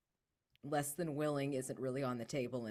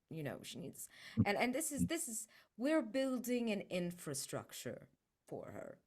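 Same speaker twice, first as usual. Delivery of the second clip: usual speaking voice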